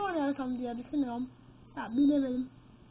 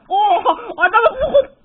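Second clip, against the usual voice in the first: loud, slow laughing shout; laughing yell; 6 semitones higher, 13 dB louder, slower than usual. Real, not acted. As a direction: crow with laughter at the hit. Laughing yell after scoring. (gol atınca gülerek bağırış)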